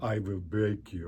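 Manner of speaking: slow, heavy Russian boxer menace; Rocky villain voice. Retold or imitated. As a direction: growl it like a cold fighter. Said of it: "even frickin' Rocky's done it".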